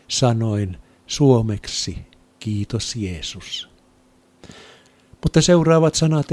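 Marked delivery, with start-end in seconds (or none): 2.4-3.7 soft, slow, hushed gratitude; heartfelt quiet thanks. Retold or imitated. Thanking Jesus from the heart at the altar, soft and slow. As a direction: breathe out thanks, slow and much softer.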